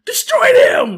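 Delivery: high, loud shouted command; villain's yelled order. Retold or imitated. Villain ordering her monsters to fire, imitated yell. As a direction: shriek it as a sudden order. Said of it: "And then she yells out".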